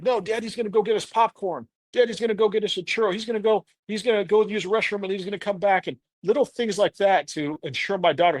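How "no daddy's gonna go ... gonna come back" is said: higher, reassuring wife voice; imitating his wife. Wife reassuring their daughter Daddy will come back, higher voice. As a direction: soothe the little girl in a higher voice. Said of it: "And she's like".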